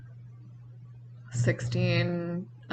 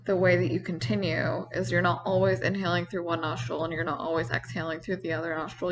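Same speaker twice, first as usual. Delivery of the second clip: ordinary talk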